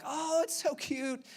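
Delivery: high, drawn-out gushing; stretched, high-pitched mock adoration; imitated cooing. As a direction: mimic a wife fawning over a baby. Imitates wife cooing at a baby.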